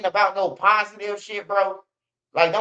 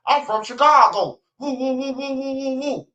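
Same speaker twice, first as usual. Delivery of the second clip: hyped-up hometown boast, higher and louder, with woo chants; mock Chicago tough guy; 6 semitones higher, 3 dB louder than usual. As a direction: brag like a loud hometown hype man.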